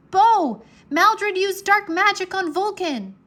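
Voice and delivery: high, loud urgent yell. A narrator's reading. Drake yelling to Bo for help, a high loud yell. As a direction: shout across the sky to Bo. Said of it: "Drake yelled".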